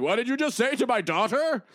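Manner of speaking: high, loud protective demand; angry father. Retold or imitated. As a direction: confront the one who insulted his daughter. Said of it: "Magneto teleported ... was like".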